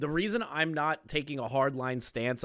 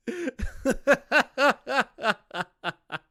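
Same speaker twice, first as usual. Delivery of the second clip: high laugh; 12 semitones higher, 4 dB louder than usual. Real, not acted. High laugh at picturing Max.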